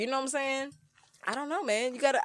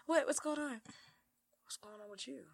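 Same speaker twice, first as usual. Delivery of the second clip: smooth line; 15 dB quieter than usual. Retold or imitated. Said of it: "you gotta".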